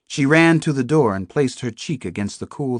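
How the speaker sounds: ordinary delivery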